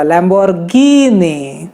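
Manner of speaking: "Lamborghini" very slowly, long ee; stretched Lamborghini. Shown as a demonstration. Teacher repeating the Italian stretch=104. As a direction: draw out "Lamborghini". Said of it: "जैसे कि मैंने बोला".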